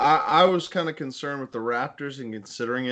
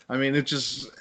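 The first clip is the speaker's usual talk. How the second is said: usual speaking voice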